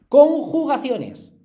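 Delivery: loud, slow topic heading; slow, emphatic heading called to the class; announcing heading. Shown as a demonstration. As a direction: call out the new section like a title. Teacher announces new topic heading.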